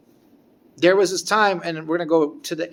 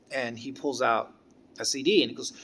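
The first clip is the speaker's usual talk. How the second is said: ordinary talk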